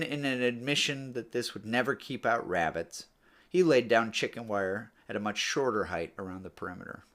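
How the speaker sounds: ordinary delivery, nothing marked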